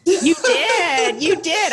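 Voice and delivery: high-pitched, laughing insistence; amused, pitched well up; laughing agreement. Real, not acted. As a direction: tease through real laughter. Amused teasing amid shared laughter.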